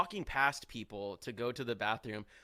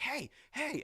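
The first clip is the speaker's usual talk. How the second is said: high-pitched held-back mock holler; mock yell; 12 semitones higher, 4 dB quieter than usual. Annoyed star calls up to the drummer, mock yell. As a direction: imitate yelling without the volume. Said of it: "about to, like, yell".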